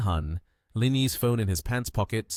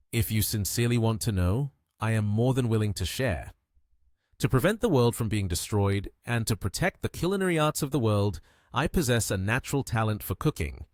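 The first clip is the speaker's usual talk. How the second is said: ordinary delivery, nothing marked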